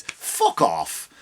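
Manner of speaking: ordinary talk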